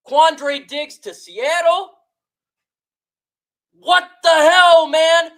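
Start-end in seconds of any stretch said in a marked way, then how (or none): 3.8-5.3 louder, higher exasperated cry; real angry what the hell; outraged outburst. Real, not acted. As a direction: blurt it out, fed up. Angry fan ranting about trade.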